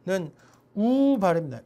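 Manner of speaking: ordinary talk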